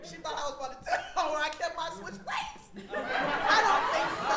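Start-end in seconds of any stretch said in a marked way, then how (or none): 2.9-4.4 slow, loud laughing taunt. Real, not acted. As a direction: tease through laughter.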